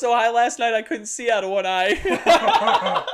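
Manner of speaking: loud, slow, laughing confession. Real, not acted. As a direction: laugh through the whole line. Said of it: "Bro, I was really fucking high".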